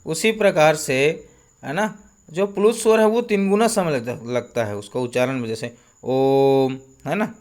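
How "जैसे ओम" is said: ओम held long, three beats; drawn-out Om. Shown as a demonstration. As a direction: stretch ओम slowly for three counts. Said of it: "ओम बोलते हैं तो ये". (ओम को लंबा खींचकर)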